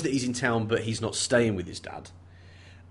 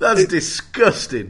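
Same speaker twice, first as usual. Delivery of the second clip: mock disgust; 8 semitones higher, 8 dB louder, much slower than usual. Real, not acted. Mock outrage at lewd theory.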